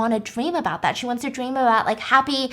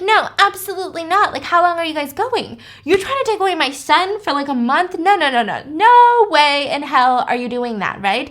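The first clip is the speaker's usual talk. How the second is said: higher, louder, outraged no; indignant refusal; 5 semitones higher, 4 dB louder than usual. Retold or imitated. Mother refusing the ex-husband's summer plan, indignant. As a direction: shut him down, appalled.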